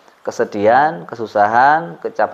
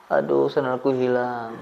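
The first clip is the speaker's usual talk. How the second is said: slow, softer mock wail; mock lament; 7 dB quieter, slower than usual. Playing a weeper answering about lost sandals, mock lament. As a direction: lament a small loss, drawn out.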